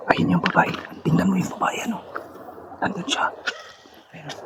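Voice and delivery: hushed sneaky whisper. Real, not acted. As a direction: whisper so she won't hear. Sneaking near a woman. (pabulong na pagsasalita)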